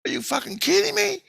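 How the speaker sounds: very high, squeaky disbelief; incredulous. Retold or imitated. Storyteller to native guy after the news, incredulous. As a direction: voice shoots way up in retold astonishment.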